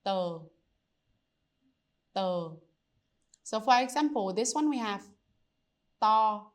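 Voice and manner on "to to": To said with no breath of wind; To with the tongue tip out, no puff; unaspirated t. Shown as a demonstration. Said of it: "try to not make any wind".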